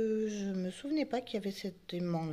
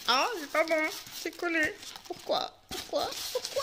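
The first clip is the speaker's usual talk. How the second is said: mock whine; 10 semitones higher than usual. Figurine stuck in the packing.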